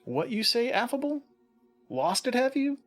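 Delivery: high, teasing put-on voice; mocking critic voice. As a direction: needle him in a high mock voice. Imagined critic mocking the host.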